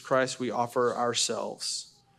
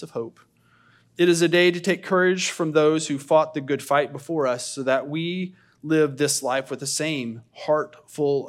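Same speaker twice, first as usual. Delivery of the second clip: earnest poem reading, louder; reading aloud; 2 semitones higher, 4 dB louder than usual. Acted. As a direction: read it like a sermon poem. Reads a poem aloud to congregation.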